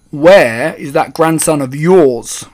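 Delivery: loud, slow, pointed demand; rude and angry. A narrator's reading. Father confronting a boy's grandmother, rude and angry. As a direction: put an angry edge on it, drawn out. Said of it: "He spoke rudely and he looked very angry".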